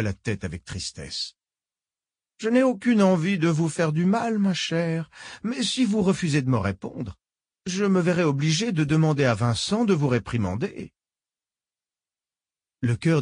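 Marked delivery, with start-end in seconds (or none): 2.4-10.9 put-on higher, sweetly menacing old lady; genteel old woman, falsely kind. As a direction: purr it like a polite old villain. Old woman threatens Nathalie.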